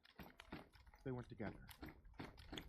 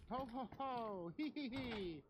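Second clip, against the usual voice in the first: put-on playful kids calling; 13 semitones higher, 4 dB louder, slower than usual.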